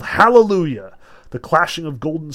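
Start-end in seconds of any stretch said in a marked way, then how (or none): 0.0-0.9 loud, raised narrated howl; howled exclamation. A narrator's reading. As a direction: howl it out, higher and much louder. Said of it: "but howled".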